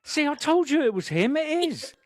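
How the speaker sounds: very high, insistent fan voice; high-pitched, eager mock exclamation; retold excited onlookers. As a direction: imitate a thrilled onlooker proved right. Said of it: "other people were like".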